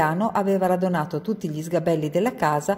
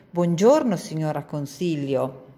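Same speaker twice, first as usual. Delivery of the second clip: ordinary delivery, nothing marked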